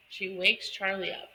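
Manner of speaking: ordinary delivery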